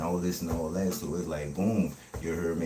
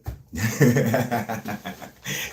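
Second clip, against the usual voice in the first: loud, higher-pitched burst of laughter; 6 semitones higher, 8 dB louder than usual. Real, not acted. Streamer after joking that viewers just listen slow, loud laugh. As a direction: laugh hard at your own comeback.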